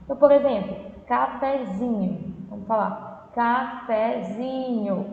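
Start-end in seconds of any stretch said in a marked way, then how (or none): 1.1-2.4 cafezinho slowly drawn out, ZI strongest; slow teaching demo of cafezinho; stress on ZINHO. Shown as a demonstration. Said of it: "o zinho é mais forte". 3.3-5.1 cafezinho very slow, syllable by syllable, ZI longest; careful slow sounding-out of cafezinho; stress on ZINHO. Shown as a demonstration. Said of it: "Percebe que o zinho é mais forte".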